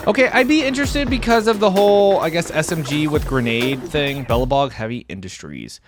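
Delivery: usual speaking voice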